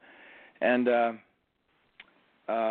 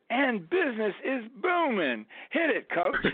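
high-pitched, triumphant Alabama call; much higher imitated Alabama holler; Alabama accent; 11 semitones higher than usual. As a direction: land the punchline as the Alabama player hollering to his coach. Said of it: "Alabama accent".

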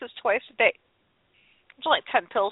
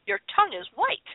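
a mother's diagnosis, pitched higher; imitating mom; 10 semitones higher than usual. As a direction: deliver it like mom with a flashlight. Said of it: "She goes".

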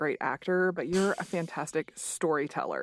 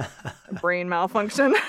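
laughing; 2 semitones higher, 5 dB louder, slower than usual. Real, not acted. Laughing at own memory lapse.

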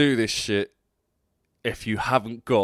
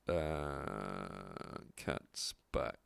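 breathy, lower, wheezy whisper; 13 semitones lower, 15 dB quieter, much slower than usual. Real, not acted. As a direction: squeeze it out on a wheeze. Wheezing after cough.

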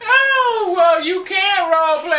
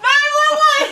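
high, helpless laughter; crying with laughter; laughing hysterically; 6 semitones higher than usual. Real, not acted. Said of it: "You okay?".

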